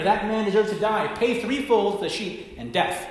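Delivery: a king screaming his verdict in anger; angry scream. Retold or imitated. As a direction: scream it out in rage. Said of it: "he screamed out in anger".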